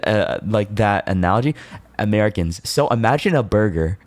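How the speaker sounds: ordinary talk, nothing marked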